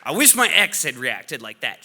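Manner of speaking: ordinary delivery, nothing marked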